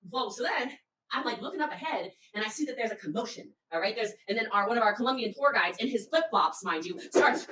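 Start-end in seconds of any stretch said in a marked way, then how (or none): none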